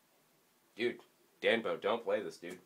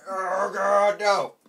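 loud, higher shouts breaking into a laugh; panicked yell; 8 semitones higher, 9 dB louder, much slower than usual. Real, not acted. Gamer yelling as his character dies. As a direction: yell in dismay then laugh. Dying again.

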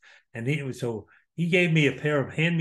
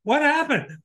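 put-on childish surprise; 13 semitones higher, 4 dB louder than usual. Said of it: "And I said".

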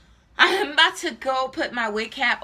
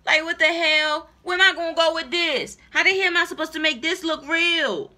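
raised, insistent, real frustration; exasperated rant; 4 semitones higher, 4 dB louder than usual. Real, not acted. Wig buyer demanding how to make it look real, exasperated. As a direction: protest it with the voice pushed up. Ranting about unusable wig.

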